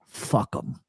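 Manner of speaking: low, near-whispered brush-off; imitated quiet dismissal. Retelling a friend's best advice, quiet dismissal. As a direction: drop to a hushed, dismissive undertone.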